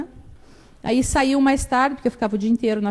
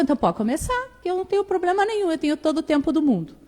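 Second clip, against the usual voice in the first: teasing grandma; 7 semitones higher, faster than usual. Retold or imitated.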